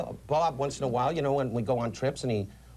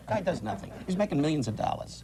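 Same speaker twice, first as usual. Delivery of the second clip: ordinary talk, nothing marked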